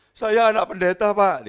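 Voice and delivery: high, loud voicing of the stranger; the man at the post office admitting it, high and loud; stranger's confession. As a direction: play the stranger blurting it out. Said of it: "dia bilang gitu ya".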